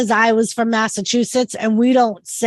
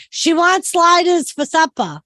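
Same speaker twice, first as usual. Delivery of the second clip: put-on higher-pitched, R-dropping Massachusetts accent; 5 semitones higher than usual. Telling brother-in-law her supper order, in Massachusetts accent. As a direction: drop the R's, Massachusetts style. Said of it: "hard time about my accent".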